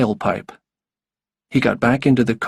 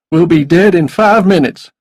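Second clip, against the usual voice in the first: loud, pitched-up wild declaration; raised, forceful villain voice; desperate, menacing man; 6 semitones higher, 8 dB louder than usual. A narrator's reading. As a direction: announce it like a man past caring. Suicidal man announces their death.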